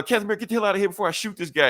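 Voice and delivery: high, fast mock threat; high, rushed hammed-up gunman; mock villain threat. Villain threatens in retold scene.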